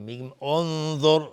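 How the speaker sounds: انظر started with u, onzor, said slow and loud; correct form. Shown as a demonstration. Teacher showing how to start the verse with onzor. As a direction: open انظر with a clear u sound.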